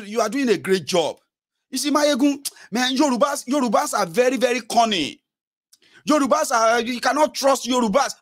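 high-pitched imitation of a flattering bigot; mock tribalist follower. Follower warning Mayegun about cunning Yorubas, mock earnest in high pitch.

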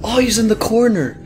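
loud, high yell of alarm; startled shout. Real, not acted. Gamer spots an enemy in the corner, startled shout. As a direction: yell it out, caught by surprise.

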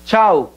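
CHAO as one syllable, "chow"; Portuguese pronunciation. Shown as a demonstration. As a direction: close CHAO with a u glide. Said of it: "Sería entonces".

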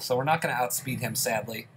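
ordinary talk, nothing marked

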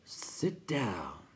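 slow, whispery creature voice; crackling. A narrator's reading. Rock Chewer tells the newcomer to sit, slow crackle. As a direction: crackle the order out slowly. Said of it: "Pjorn Krachark crackled".

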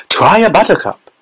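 high, sing-out invitation; eager cry. A narrator's reading. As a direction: call it out eagerly, pitched high. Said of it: "cried Mister Wonka".

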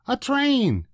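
put-on high child's voice, eager; little boy. A narrator's reading. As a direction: point it out to daddy.

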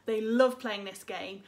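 usual speaking voice

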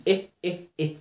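"إيه" as a short e, like in bed; short e sound. Shown as a demonstration. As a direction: repeat a short, separate "إيه". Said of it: "بتعمل فيها شورت إي".

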